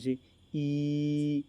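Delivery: ई held very long and slow; ई prolonged like a chant; drawn-out ई. Shown as a demonstration. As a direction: stretch the vowel ई to triple length. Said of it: "स्वर का उच्चारण अपन तीन बार करते हैं".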